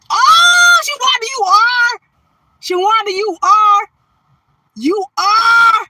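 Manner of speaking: high, loud delighted shrieks; squealing with joy at a viewer; excited squeal. Real, not acted. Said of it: "shut up".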